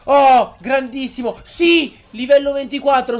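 high, loud, elated cry; real burst of joy; excited cheer. Real, not acted. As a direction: celebrate the level up at the top of your voice. Celebrating a level up.

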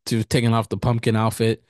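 ordinary delivery

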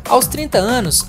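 ordinary talk, nothing marked

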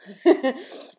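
loud, teary burst of laughter; a real laugh bursting out to shake off the tears; embarrassed laugh. Real, not acted. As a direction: laugh off the emotion, real and sudden. Embarrassed laugh after getting emotional.

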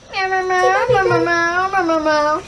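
playful kitten meows; cat meowing. Pretend. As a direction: meow like a cat.

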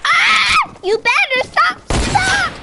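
high yelling at an attacker; frantic game yells; panicked shout. Real, not acted. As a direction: shriek it to make them stop.

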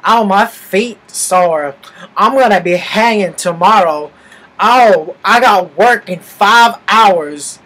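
slow, loud mocking whine; exaggerated drawling imitation of a tipsy complainer; blabbing drunk girl. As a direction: mimic her complaining in long, dragged-out words. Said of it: "she's blabbing about".

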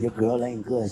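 ordinary talk, nothing marked

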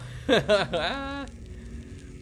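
high, delighted laugh; burst of laughter. Real, not acted. Viewer cracking up at a joke in the credits.

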